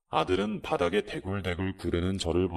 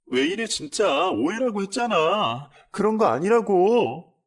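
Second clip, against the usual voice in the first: very high, loud desperate shout; panicked daughter-in-law's cry; shrill pleading; 13 semitones higher, 8 dB louder than usual. Retold or imitated. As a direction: scream at him to stop. Daughter-in-law stops fight.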